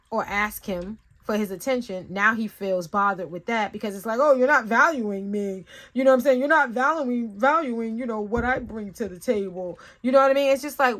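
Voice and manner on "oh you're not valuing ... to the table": louder, higher mock grievance; put-upon coworker, lightly acted; imitated offended complaint. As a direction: mimic someone feeling undervalued.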